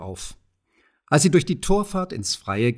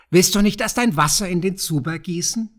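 higher, falsely friendly goblin voice; sly goblin; 6 semitones higher than usual. A narrator's reading. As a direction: slip the trap in with a smirk. Kobold feigns helpfulness.

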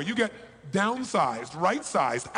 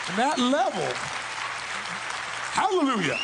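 preacher's cry; 6 semitones higher than usual. Acted. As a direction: belt it out like a revival sermon. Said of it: "Feels like a sermon at this point".

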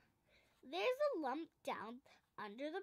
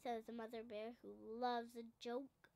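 usual speaking voice

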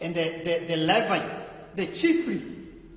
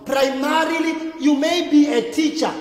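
emphatic preaching; 6 semitones higher, 7 dB louder than usual. Acted. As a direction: drive the point home to the church. Preacher stresses his point.

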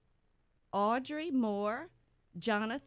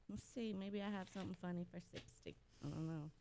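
much softer, hurried aside; muttering to self; 4 semitones lower, 13 dB quieter, much faster than usual. Real, not acted. Host looking for something funny for sixty, muttering to herself. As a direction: quietly think aloud while searching.

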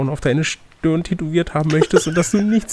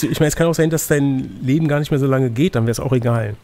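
normal talking voice

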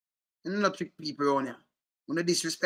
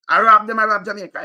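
angry loud; 6 semitones higher, 10 dB louder than usual. Real, not acted. Angry rant about politicians.

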